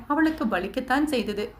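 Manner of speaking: usual speaking voice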